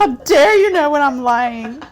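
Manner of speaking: put-on high, loud, offended child voice; indignant kid. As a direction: play the outraged kid caught lying.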